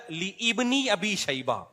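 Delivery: slow, emphatic لی ابنی with "ibnī" sounded; mistaken correction. Retold or imitated. Mimicking the engineer correcting others, mistaken correction. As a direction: draw out لی ابنی as two words. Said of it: "فلاں لوگ غلط پڑھتے ہیں".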